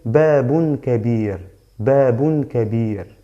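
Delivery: babun kabir with kabir cut after the r, slow and clear; slow teacher demo leaving the ending off kabir; ending dropped, slow. Shown as a demonstration. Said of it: "Entonces omité doble damma".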